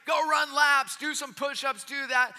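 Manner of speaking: high, loud mock coach yelling. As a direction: bark orders like a drill coach firing up his team. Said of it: "are yelling and trying to fire their team up".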